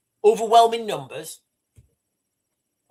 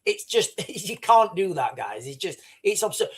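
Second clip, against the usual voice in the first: ordinary talk, nothing marked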